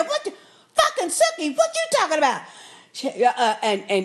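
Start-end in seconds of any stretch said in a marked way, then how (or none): 0.0-2.4 a hint of a furious brother; mock angry Jason. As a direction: act out Jason's outrage, high and indignant. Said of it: "Jason gets mad".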